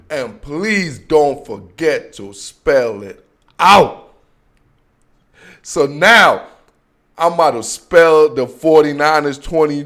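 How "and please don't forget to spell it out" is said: dragged-out slow catchphrase; very slow tagline. Pretend. Host repeating his sign-off catchphrase, very slow. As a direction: draw every word out like a sign-off.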